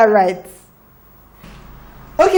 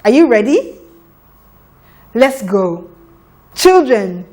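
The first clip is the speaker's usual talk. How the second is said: ordinary talk, nothing marked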